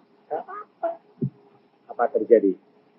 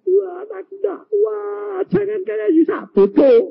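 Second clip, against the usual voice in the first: high, drawn-out whine to God; exaggerated pleading, pitched high; mock complaint; 14 semitones higher, 4 dB louder than usual. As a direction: wail it slowly like a hammed-up weakling. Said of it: "Kamu jangan lalu bilang gini".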